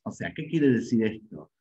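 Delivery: ordinary talk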